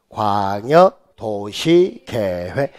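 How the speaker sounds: slow classroom recitation; repeat-after-me. Shown as a demonstration. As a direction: stretch the term so students can chant along. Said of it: "따라서요".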